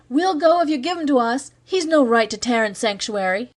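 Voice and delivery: high defiant yell from the crowd. Acted. A mob member yelling a demand at Cargill, high defiant yell. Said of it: "one of the mob yelled".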